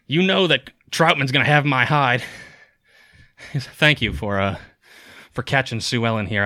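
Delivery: a touch of a worn-out farmhand kobold; lightly voiced winded kobold. Kobold thanks strangers for catching his pig, lightly voiced exasperation. Game master plays the kobold.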